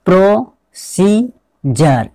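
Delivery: प्रोसीजर stretched out slowly, with a "jar" ending; syllable-by-syllable pronunciation. Shown as a demonstration. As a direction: sound out प्रोसीजर slowly, part by part. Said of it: "इसको क्या पढ़ेंगे". (प्रोसीजर को धीरे-धीरे तोड़कर, अंत में "जर")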